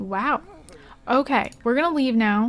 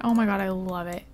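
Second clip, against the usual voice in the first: ordinary talk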